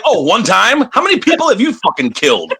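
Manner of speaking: incredulous retort. Retold or imitated. Retorting to a wrestler, high mock disbelief.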